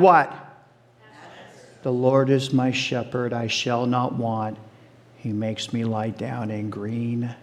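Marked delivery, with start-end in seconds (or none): 1.8-7.3 hushed recitation. Acted. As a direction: recite the psalm quietly, almost to yourself. Reciting Psalm twenty-three.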